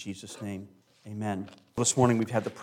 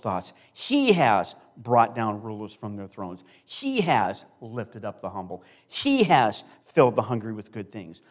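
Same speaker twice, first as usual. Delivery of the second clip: emphatic scripture reading; 3 semitones lower, 4 dB louder than usual. Shown as a demonstration. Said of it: "I'm gonna read it with some emphasis".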